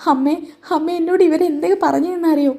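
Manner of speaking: high, whining complaint to her mother; mock tearful whine. Daughter whines to her mother about insults, mock tearful. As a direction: play a daughter pretending to cry. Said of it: "എൻ്റെ അനാമിക മോൾ കരയുന്നത്".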